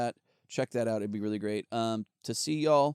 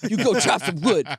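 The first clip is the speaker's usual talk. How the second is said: higher, louder repeat, barking the command; louder mock order; 5 semitones higher, 7 dB louder than usual. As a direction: push harder the second time. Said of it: "I'd be like".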